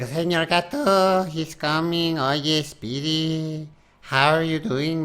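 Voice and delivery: lightly hammed-up Mexican accent, slow on "Señor Gato" and "Oye, Speedy"; mock Speedy Gonzales accent. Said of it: "He's like".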